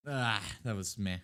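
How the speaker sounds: ordinary delivery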